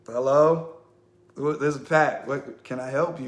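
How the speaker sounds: loud, puzzled hello; calling into phone. Retold or imitated. He calls out to a silent unknown caller, loud and puzzled. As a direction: shout into the phone for an answer.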